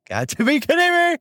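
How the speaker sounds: very high, stunned disbelief; mock amazement. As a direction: sell the "no way" moment. Amazed at lucky timing.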